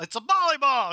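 It's a volleyball blurted out high and loud; exclaimed. Real, not acted. Preacher stressing the absurdity to the congregation, loud exclaim. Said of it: "emphatic exclamation".

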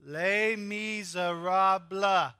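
"Les Miserables" said very slowly as "lay miz-er-ahb"; careful, slow French "Les Miserables"; French pronunciation. Shown as a demonstration. Pastor leading the church in the French title.

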